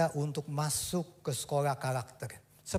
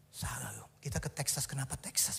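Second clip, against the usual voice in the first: hushed unvoiced whisper of a worried son; salah loh and Texas breathed in a whisper; whispered complaint; 6 semitones lower, 9 dB quieter than usual. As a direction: whisper it like a sad boy. Son's worried complaint retold.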